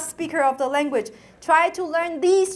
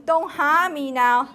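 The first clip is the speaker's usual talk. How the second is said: ordinary talk, nothing marked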